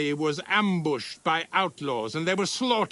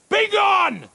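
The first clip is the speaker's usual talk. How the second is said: commanding shout; 8 dB louder than usual. Acted. Official dismissing the pair.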